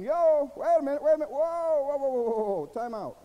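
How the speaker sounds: loud, high acted protest, easing off at the end; Christ interrupting. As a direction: cut in urgently to halt someone, acted big. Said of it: "Christ is inside of you saying".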